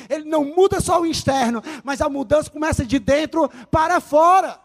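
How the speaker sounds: rising from firm to shouted preaching; building to a preaching shout. Acted. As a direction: build the sermon to a roar.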